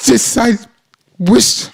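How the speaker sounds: mock tearful voice, pitched high; breaking down. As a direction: ham up a weepy breakdown. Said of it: "breaking down and talking like".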